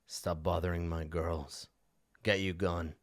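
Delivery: low, soft, slow dismissal; surly dog owner. Acted. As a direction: send them off quietly in a low voice. Game master voices gruff man with dogs.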